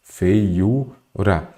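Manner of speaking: "Feiura" dividido em "fei-u-ra"; separado em sílabas. Shown as a demonstration. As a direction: diga "Feiura" sílaba por sílaba, com pausas. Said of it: "vamos separar aqui em sílabas". ("Feiura" silabado, como quem ensina a separar)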